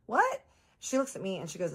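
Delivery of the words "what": very high, rising what; shocked what. Retold or imitated. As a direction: jump in with disbelief. Shocked at Harley's claim.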